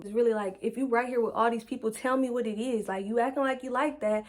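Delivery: usual speaking voice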